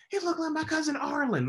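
noticing the lookalike; amazed exclaim. Retold or imitated. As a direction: exclaim in disbelief. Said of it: "I'm like".

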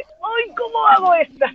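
a mock helpless squeak; flustered. Actress imitating herself struggling with the accent, flustered. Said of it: "era como que".

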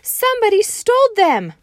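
very high, loud upset wail; frantic kid cry. Acted. Junie B. reporting stolen mittens, high loud wail. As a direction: cry out the theft in alarm. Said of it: "I hollered".

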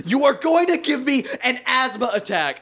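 high, strained mock scream; hammed-up outraged shriek; imitated screaming driver. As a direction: scream it like a ranting bus driver, without full volume. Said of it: "the driver started screaming and was like".

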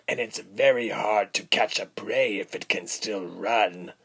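rough-voiced schemer toying with captive; coarse villain, menacing. A narrator's reading. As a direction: threaten calmly, taking your time. Villain explains hunt to captive.

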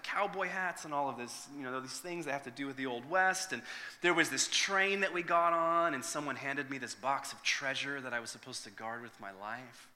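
usual speaking voice

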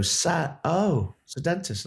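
the crowd catching on; mock audience realisation. Audience realising the dentist picture.

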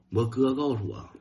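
ordinary delivery

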